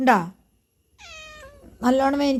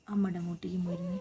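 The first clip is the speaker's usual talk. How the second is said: quiet, tearful murmur over sobs; soft words through crying; 3 semitones lower, 11 dB quieter than usual. Real, not acted. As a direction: speak softly while crying. Hurt child crying, mother soothing. (കരച്ചിലോടെ പതുക്കെ)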